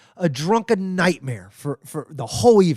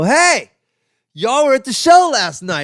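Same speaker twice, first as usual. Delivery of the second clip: much higher and louder, eager hey; excited greeting; 9 semitones higher, 5 dB louder than usual. Retold or imitated. Marines greeting the comedians in the hallway, high eager call. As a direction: hail the comedians like old friends. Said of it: "And they go".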